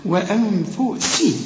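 slow "وأنفسي" with an open a and light seen; careful teaching recitation of "وأنفسي"; correct light recitation. Shown as a demonstration. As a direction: stretch the hummed n in "وأنفسي", then a clear "si". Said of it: "هتقول إيه بقى؟".

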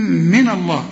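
usual speaking voice

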